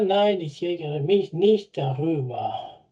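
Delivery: slow, careful German example; deliberate teacher pace; slow model sentence. Shown as a demonstration. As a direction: read it slowly for learners. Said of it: "Por el mal tiempo".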